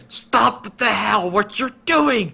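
high, stretched mock scream without real volume; retold frantic scream. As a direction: screech it slowly in imitation. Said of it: "basically screaming in his ear".

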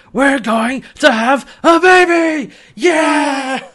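high, loud, drawn-out triumphant yell; hammed-up whoop of joy; jubilant shouting. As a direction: proclaim it to the whole sky. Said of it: "he stands up and throws his arms out".

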